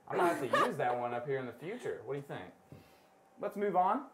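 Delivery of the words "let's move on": ordinary talk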